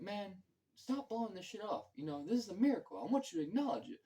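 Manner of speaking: high, urgent preacher-like insistence; insistent Jules. Retold or imitated. Jules to Vincent, insisting, in a high urgent voice. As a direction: press him to admit the miracle.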